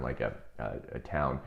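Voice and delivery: ordinary delivery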